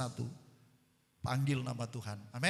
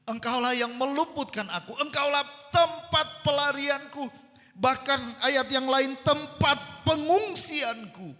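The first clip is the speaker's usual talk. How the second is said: raised, loud preacher's delivery; impassioned preaching; 10 semitones higher, 10 dB louder than usual. Real, not acted. Preacher telling how hungry believers received rice, impassioned. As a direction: preach it high and forceful.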